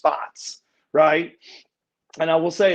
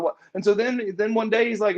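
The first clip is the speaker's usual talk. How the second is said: ordinary talk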